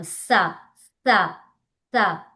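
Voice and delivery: సా with tongue pushed out between the teeth; three isolated, falling dental సా sounds. Shown as a demonstration. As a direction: demonstrate a dental సా, syllable by syllable. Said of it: "టంగ్ని బయటకు తీసినాం".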